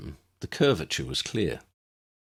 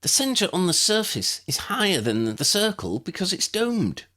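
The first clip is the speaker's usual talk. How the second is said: a lightly voiced young woman, high and polite; girl's voice asking a favour; Lydia's voice; 12 semitones higher than usual. A narrator's reading. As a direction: ask it as the girl, politely.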